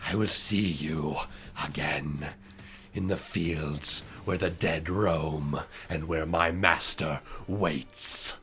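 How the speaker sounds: low, slow, fading villain voice; a dying villain's last words. Acted. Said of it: "He coughs and says".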